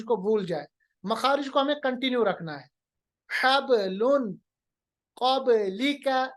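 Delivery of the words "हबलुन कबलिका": हबलुन, कबलिका slow with bounced b; qalqalah. Shown as a demonstration. Teacher drills examples, हबलुन and कबलिका with springing b. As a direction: echo each b in हबलुन and कबलिका. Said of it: "कब नहीं पढ़ेंगे".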